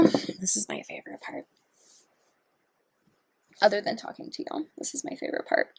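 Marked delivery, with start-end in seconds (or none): 3.6-5.7 hushed repeat. Real, not acted.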